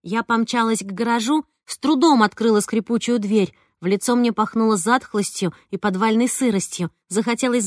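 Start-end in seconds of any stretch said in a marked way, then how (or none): none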